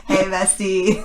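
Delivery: drawn-out teasing hey bestie, with a laugh; playful mock greeting. Teasing a co-host about a journalist bestie, playful mock greeting. Joking about host's best buddy.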